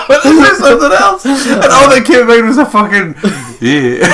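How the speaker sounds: high, loud, laughing through the words; real laughter bubbling over. Real, not acted. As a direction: giggle helplessly as you talk. Laughing fit.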